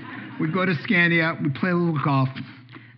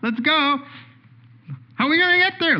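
bouncing with excitement to leave; eager grandpa; 12 semitones higher than usual. Acted. Grandpa rushing his grandson out the door, high and eager. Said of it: "let's go outside your house".

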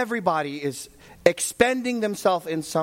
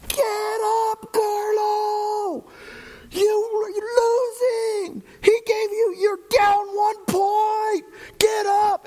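very high, desperate shout, slow and strained; 4 dB louder, slower than usual. Retold or imitated. Father yelling from the stands at his wrestling son, desperate shout. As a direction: yell it from the stands with everything. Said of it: "I just shouted as loud as I could".

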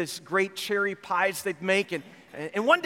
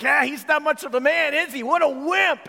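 high, louder sneering biker jeer; mocking jeer; 6 semitones higher, 4 dB louder than usual. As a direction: make fun of him, ringing with scorn. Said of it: "they're making fun".

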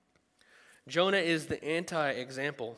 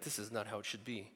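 muttered complaint; 5 semitones lower, 11 dB quieter than usual. Retold or imitated. Grumbling in grocery line.

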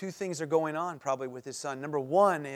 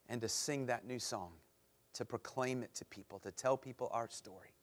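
prayer; 3 semitones lower than usual. Acted. Closing prayer.

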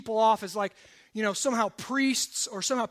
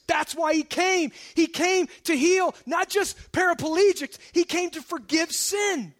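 emphatic, raised preaching voice; impassioned preaching; 4 semitones higher, 5 dB louder than usual. Acted. Preacher urging sinners that Christ wants them, impassioned. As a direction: drive each phrase home to the congregation.

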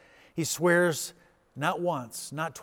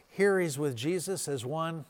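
normal talking voice

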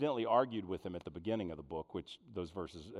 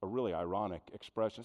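ordinary delivery

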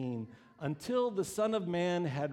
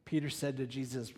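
ordinary talk, nothing marked

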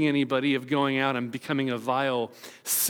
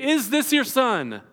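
higher, slow interrogating question; stern questioner; 8 semitones higher, 3 dB louder, slower than usual. Retold or imitated. Pharisee questioning the frightened parents, slow and raised.

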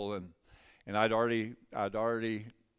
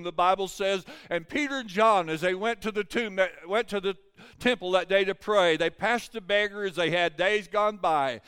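high, raised sermon voice; preaching at full force; 7 semitones higher, 6 dB louder than usual. Real, not acted. Preacher tells of Peter and John at the temple, forceful preaching. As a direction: drive the Bible story home to the congregation.

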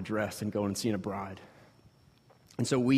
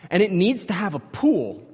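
insistent; 8 semitones higher, 6 dB louder than usual. Retold or imitated. Urgent thought.

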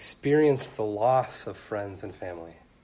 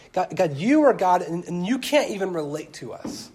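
loud, high, pleading complaint to God; begrudging lament to God; 7 semitones higher, 10 dB louder than usual. Retold or imitated. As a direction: hurl the complaint up at God, much louder and higher. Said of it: "he's kind of saying".

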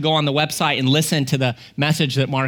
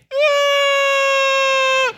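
long, very high shriek; imitated child's piercing wail; toddler scream; 3 dB louder than usual. Toddler's scream after dreaded silence.

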